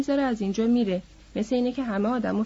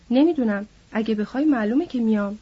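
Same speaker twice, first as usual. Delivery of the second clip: ordinary delivery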